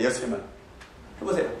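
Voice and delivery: usual speaking voice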